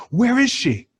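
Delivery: high, urgent mock panic; retold friend's panicked high question; frantic demand. Frantic friend grabbing him.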